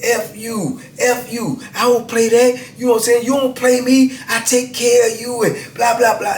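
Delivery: imitating a wife telling off her cheating husband; mock angry wife. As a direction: play the furious wife, pitch pushed up. Angry wife confronting husband at visit.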